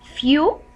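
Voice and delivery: "Few", stretched teaching syllable left hanging; slow first syllable. Shown as a demonstration. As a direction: hold "Few" alone as half a word. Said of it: "pronounce it in a slow way".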